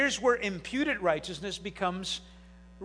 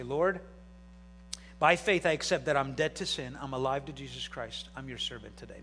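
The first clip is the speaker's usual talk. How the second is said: soft prayer; 7 semitones lower, 5 dB quieter than usual. Acted. Pastor modelling a morning prayer, soft and hushed. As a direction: pray it quietly, like waking up.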